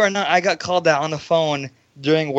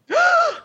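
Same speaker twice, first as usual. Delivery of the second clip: mock gasp. Mocks own shock at insult.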